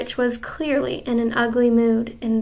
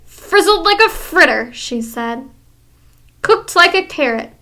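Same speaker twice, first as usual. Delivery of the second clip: higher, louder gleeful voice; gloating witch; 3 semitones higher, 5 dB louder than usual. Acted. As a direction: gloat over the victim.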